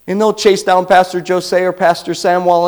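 ordinary talk, nothing marked

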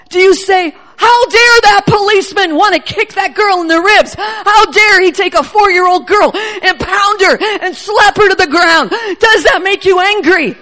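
loud, indignant outcry; angry preaching. Retold or imitated. Preacher voicing the Lord's anger at cruelty, loud and indignant. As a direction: let the outrage ring out. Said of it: "The Lord says".

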